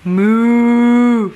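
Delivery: playful mooing; cow moo. Pretend. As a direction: moo it out for the child. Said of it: "A cow says".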